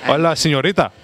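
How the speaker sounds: put-on playful greeting in a Spanish accent. Host plays along with the Spanish request, light Spanish accent. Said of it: "Speak with a Spanish accent".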